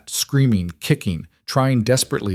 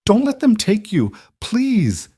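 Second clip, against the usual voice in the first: put-on a child's high, pleading, tearful cry; a little girl begging through tears not to lose her mother; 13 semitones higher than usual. A narrator's reading. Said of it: "starting to cry".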